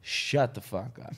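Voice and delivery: slow, low and quiet; no-punchline put-down. Retold or imitated. Comic to heckler mid-set, slow quiet put-down. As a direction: say it slowly, with no joke in it. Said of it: "No punchline, no".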